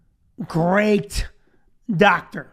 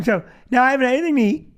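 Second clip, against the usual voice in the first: ordinary delivery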